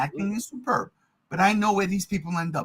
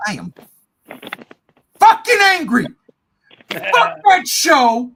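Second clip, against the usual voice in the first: loud, high, exploding in anger; pause, then blast it out loud and high; angry outburst; 7 semitones higher, 8 dB louder than usual. Real, not acted. Host declaring his anger at the show, exploding.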